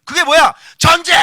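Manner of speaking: 높고 크게 길게 외치기; 싸움을 선포하듯 내지르기. Retold or imitated. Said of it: "뭐 이런 거잖아". (전쟁 선포하듯 고함)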